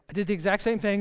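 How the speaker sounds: ordinary delivery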